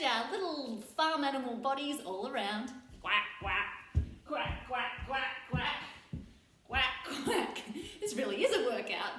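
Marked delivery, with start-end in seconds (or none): 3.0-7.6 duck quacks. Shown as a demonstration. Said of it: "I'm gonna be a duck".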